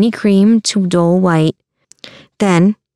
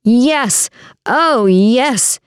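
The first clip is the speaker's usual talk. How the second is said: higher-pitched drawn-out agreement; theatrical lament; 5 semitones higher, much slower than usual. A narrator's reading. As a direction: agree with a mock-woeful moan. Giovanni milking the crowd's sympathy.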